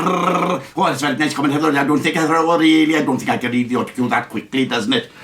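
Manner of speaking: put-on Welsh accent, rushing toward the end; mock Welsh accent. Mocking the Welsh leader at the autocue, Welsh accent. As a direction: mimic a Welsh politician fumbling the autocue. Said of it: "that Welshman who speaks like this".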